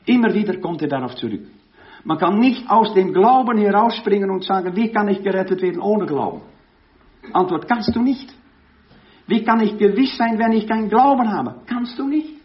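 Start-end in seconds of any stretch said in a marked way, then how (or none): 2.0-12.4 loud, high, driving sermon peak; preaching at full force. Acted. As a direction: hammer the point home to the congregation. Prediger steigert sich. (predigt mit voller Kraft, laut und eindringlich)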